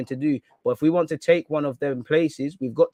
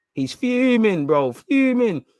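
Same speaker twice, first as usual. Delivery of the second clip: mocking tease; 7 semitones higher, 6 dB louder, much slower than usual. Mocks the angry manager.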